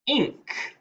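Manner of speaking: "ink" split off and sung up high; chunked ending. Shown as a demonstration. Teacher chunking the word link for spellers, high. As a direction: pull "ink" apart from the L. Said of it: "My root word is link".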